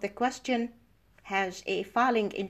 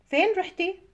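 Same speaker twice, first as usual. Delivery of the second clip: فين رحتي lifted higher, rising at the end; rising question intonation; 8 semitones higher, much faster than usual. Shown as a demonstration. Teacher showing an Arabic question, فين رحتي with rising end. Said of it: "the end of the question is rising".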